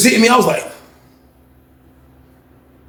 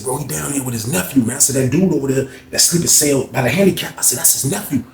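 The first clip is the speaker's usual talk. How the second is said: softer, lower, secretive; low hushed voice; 6 semitones lower, 6 dB quieter, faster than usual. Retold or imitated. Warning his cellmate about Frank, hushed. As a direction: keep it low so nobody hears.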